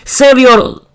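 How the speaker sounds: high, frantic cry for help; frightened high shout; panicked shout. Acted. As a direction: yell in panic as the roof tears off. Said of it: "the family shouted in panic".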